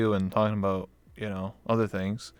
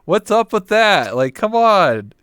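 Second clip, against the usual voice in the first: loud, drawn-out exasperation; exasperated complaint; 7 semitones higher, 9 dB louder, slower than usual. Real, not acted. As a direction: vent your frustration loudly. Complaining about a chat bug.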